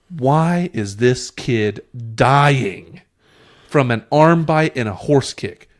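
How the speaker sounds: drawn-out exasperated question; slow exasperated. Real, not acted. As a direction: drag it out in disbelief. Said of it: "exasperated slow question".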